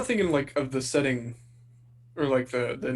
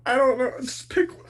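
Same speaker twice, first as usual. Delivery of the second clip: flustered laugh; 10 semitones higher, 4 dB louder, slower than usual. Real, not acted.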